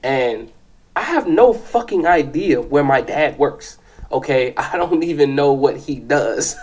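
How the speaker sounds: ordinary talk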